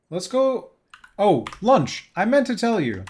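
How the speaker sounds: high, loud excited greeting; light up greeting a friend. Real, not acted.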